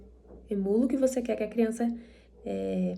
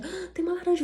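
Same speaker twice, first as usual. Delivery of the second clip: delighted discovery; 9 semitones higher, faster than usual. Acted. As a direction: act out a happy find for a child. Descoberta animada com a criança. (descoberta animada, em tom agudo)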